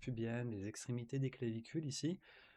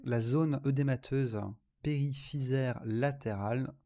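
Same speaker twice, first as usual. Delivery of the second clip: dictating; 3 dB louder than usual. Shown as a demonstration. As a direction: dictate it clearly, word by word. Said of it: "C'est parti pour la dictée".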